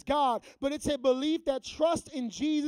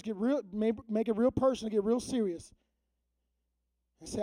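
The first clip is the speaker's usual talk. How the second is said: normal talking voice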